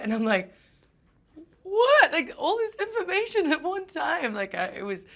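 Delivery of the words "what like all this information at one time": much higher and louder shocked cry; overwhelmed what. Retold or imitated. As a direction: explode in disbelief. Told of the movie.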